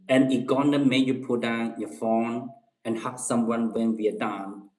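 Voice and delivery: ordinary talk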